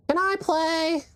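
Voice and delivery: put-on high, drawn-out little kid plea; squeaky imitation of a kid asking; begging kid. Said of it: "And Marky's more like".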